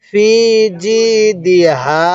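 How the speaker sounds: ফি and জি drawn out, then দি হা; measured ফি জি দি হা with pauses between; slow model reading. Shown as a demonstration. Teacher showing how to read, stretched ফি and জি. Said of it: "হ্যাঁ, পড়ুন".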